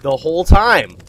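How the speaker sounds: high, loud burst on the twist; loud, emphatic, rising then dropping; loud exclamation. Acted. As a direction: belt it out as a big reveal.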